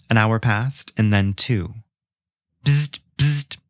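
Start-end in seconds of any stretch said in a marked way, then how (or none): 2.6-3.6 buzzing phone ring. A narrator's reading. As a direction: mimic a phone ringing, bzzzt. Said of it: "said over the phone".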